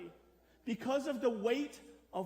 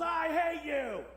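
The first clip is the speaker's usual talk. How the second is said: slow, higher, louder hateful shout; angry yell; 6 semitones higher, 5 dB louder, slower than usual. Retold or imitated. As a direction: bark it at a passer-by like an angry protester. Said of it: "yells at you and goes".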